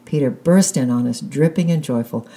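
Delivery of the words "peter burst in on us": plain reading voice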